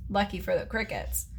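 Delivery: usual speaking voice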